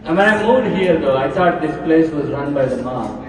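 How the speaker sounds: normal talking voice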